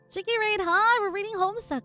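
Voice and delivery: high, bubbly raid greeting; cute anime streamer. Real, not acted. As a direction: squeal a cheerful hello to viewers.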